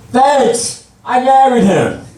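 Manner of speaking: high, pushed sarcastic holler; sarcastic call-out. Acted. Wife to the driver beside them, sarcastic holler. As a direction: call it out high to the next car.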